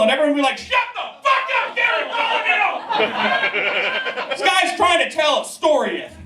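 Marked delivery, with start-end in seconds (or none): 0.6-1.8 high shouted shut up; mock crowd yell. Crowd yells at German guy. 1.8-6.2 laughing scold. Retold or imitated.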